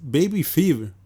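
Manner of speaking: normal talking voice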